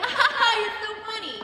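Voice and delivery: high, loud fake laugh; mock laughing. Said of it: "I was like".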